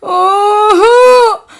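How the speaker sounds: high, drawn-out wail into a whine; hammed-up tearful toddler; crying child. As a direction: demand it from dad, bawling slowly. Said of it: "ಮಗು ಆದಂಥ ಬೀರ್ಬಲ್ಲ".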